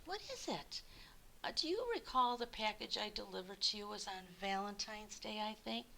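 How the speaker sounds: soft, hesitant, worried mumble; upset and nervous. Acted. Worried postman confessing his mistake, hesitant and hushed. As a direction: stammer it out quietly, afraid of trouble. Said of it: "You look upset".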